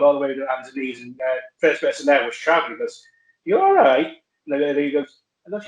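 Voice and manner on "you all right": much higher, mock-worried voice; imitating Trav's concern. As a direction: play the friend asking if he's okay, pitched way up. Trav checks on injured speaker.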